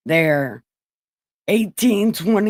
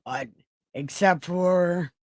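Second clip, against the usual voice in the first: ordinary delivery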